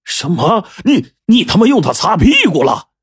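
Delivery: can't believe what he just heard; shocked and doubtful. Acted. Boss Laowai reacts to the map being used as toilet paper, incredulous. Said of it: "老歪是又惊又疑啊".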